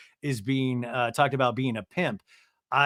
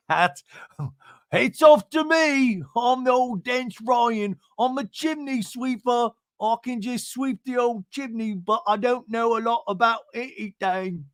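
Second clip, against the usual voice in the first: bad Cockney accent; 8 semitones higher than usual. Mocking own ignorance as a dense chimney sweeper, hammed-up Cockney. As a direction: play a daft sweep in fake Cockney.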